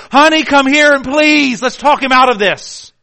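panicked mother crying out for help; frantic cry for help. Acted. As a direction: shout for help to save her son.

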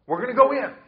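high, loud mock eagerness; imitating the people suddenly eager to go in; mock eager boast. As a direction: throw it up high like cocky late bravado. Mocking eager Israelites' reversal.

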